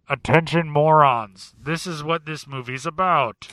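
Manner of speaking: loud, slow announcer; mock public address to moviegoers; mock announcement. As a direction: bellow it at the audience.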